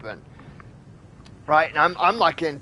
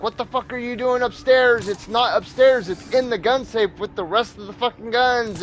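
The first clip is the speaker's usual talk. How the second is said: higher-pitched exasperated rant; retold arguing; 5 semitones higher than usual. Husband arguing with wife searching upstairs for the gun, exasperated. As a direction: argue in disbelief, as if through a window. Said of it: "I'm, like, fighting with her".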